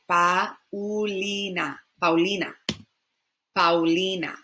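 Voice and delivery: Paulina as pow-LEE-nah; Spanish pronunciation. Shown as a demonstration. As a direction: Paulina with flowing AU. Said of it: "but in Spanish".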